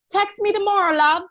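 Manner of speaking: mock the guy's fake British goodbye; fake British accent. Guy leaving roommate's place, light fake British accent. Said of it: "he did a fake British accent".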